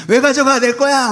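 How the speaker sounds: high, loud "it's mine!" outburst; mock tantrum. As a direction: play the greedy one refusing to give back. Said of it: "막 이런 스타일이에요".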